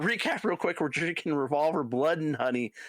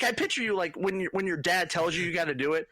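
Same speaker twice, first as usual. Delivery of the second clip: ordinary delivery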